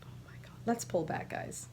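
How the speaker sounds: hushed, breathless awe; awed whisper. Real, not acted. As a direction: whisper it in wonder. Awed by finished socks.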